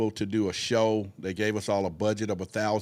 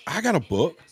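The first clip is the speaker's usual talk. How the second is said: excited; 7 semitones higher, 4 dB louder than usual. Real, not acted. Sudden realization, excited.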